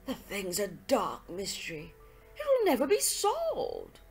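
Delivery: dramatic, defeated exclamation; exclaiming. Acted. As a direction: cry out that it's hopeless. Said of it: "I cried".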